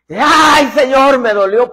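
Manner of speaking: loud, high pained yelp to the Lord; hammed-up loud ouch; mock cry of pain. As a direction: howl it like you just got smacked. Cry of pain after God's spanking.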